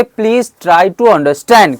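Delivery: slow, careful teacher model for the class to repeat; slow model sentence. Shown as a demonstration. Teacher models a sentence slowly for students to repeat. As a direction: say each word slowly and clearly. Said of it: "क्या बोलेंगे?".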